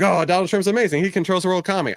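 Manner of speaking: high, gushing mock praise; gushing fan. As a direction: gush over him like a fanboy. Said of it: "I don't mean to be like".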